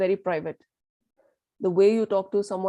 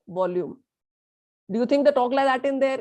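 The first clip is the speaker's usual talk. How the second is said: usual speaking voice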